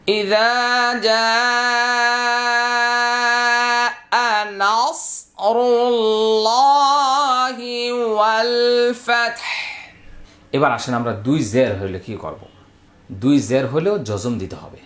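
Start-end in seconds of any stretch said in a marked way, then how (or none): 0.1-9.4 long drawn melodic chant, very slow; Quranic recitation. Shown as a demonstration. Teacher modelling the verse for the class, drawn-out chant. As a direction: chant each vowel out long. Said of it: "teacher recites full verse".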